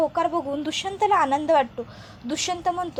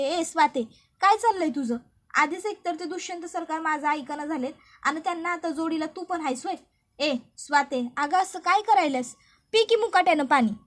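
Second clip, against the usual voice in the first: scolding. Acted. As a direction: tell her off sharply to drink. Krishna scolding the cow Swati.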